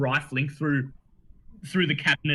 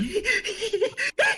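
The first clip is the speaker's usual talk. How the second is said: high giddy cackle while bobbing up and down; excited gnome cackle. Acted. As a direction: cackle with glee. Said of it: "enthusiastically bobbing up and down and going".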